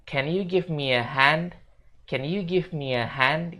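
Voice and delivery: "Can you give me a hand" slow, "me a" as "mia"; slow linked sentence. Shown as a demonstration. Teacher models the full request slowly. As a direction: link it all, slowly. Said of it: "Jadi fullnya bakal kayak gini".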